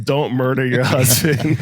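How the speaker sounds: slow, deadpan warning; mock book advice. Husband imitating his wife's parenting book, deadpan. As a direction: read it like a stern manual. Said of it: "What do you think her books say".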